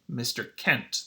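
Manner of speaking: ordinary talk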